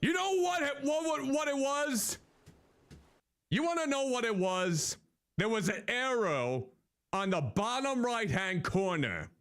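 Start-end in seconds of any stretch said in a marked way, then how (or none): 0.0-5.0 high, eager telling; bursting to share the news; excited. Real, not acted. Excited after finding the arrow.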